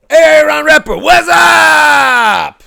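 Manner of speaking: loud, high, drawn-out greeting; excited shout-out. Real, not acted. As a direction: call out happily across the room. Greeting a viewer who finally arrived.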